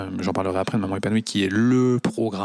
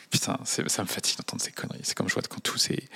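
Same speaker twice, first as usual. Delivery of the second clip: muttered exasperation under the breath; fed-up whisper; 7 dB quieter, faster than usual. Real, not acted. Host tired of hearing nonsense, muttered. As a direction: grumble it half to yourself.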